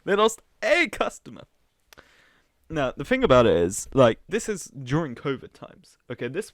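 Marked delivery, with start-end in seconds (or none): 0.0-1.4 "They lost a customer" raised higher and louder in sarcastic alarm; mock outrage. Shown as a demonstration. Streamer sarcastically mourning Pizza Hut's loss, loud mock alarm. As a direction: overplay the tragedy, pitched up. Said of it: "One single customer".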